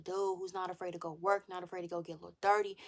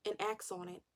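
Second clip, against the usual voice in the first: ordinary talk, nothing marked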